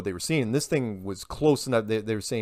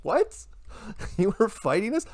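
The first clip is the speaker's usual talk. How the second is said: high, mock-startled disbelief; 9 semitones higher than usual. Mocking scientists who suddenly claim the find, high and incredulous. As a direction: squeak it out like you can't believe it. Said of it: "It's like".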